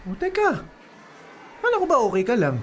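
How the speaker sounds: startled confusion. Acted. Boyfriend startled by her anger.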